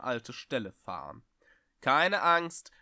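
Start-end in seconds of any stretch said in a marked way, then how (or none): 1.8-2.7 calling out. Acted. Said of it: "rief der Schrecksmeister".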